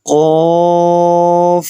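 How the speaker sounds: قاف held long and full with an echoing bounce; heavy qalqalah letter. Shown as a demonstration. Teacher reciting قاف for students, full and echoing. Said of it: "منہ بھر کر یعنی قلقلہ کے ساتھ".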